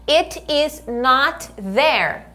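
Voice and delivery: slow, careful model phrase for the class; slow model sentence. Shown as a demonstration. Teacher modelling a sentence slowly for repetition. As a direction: spell it out slowly for students to repeat. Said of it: "bora lá, repeat".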